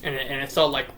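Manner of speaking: ordinary talk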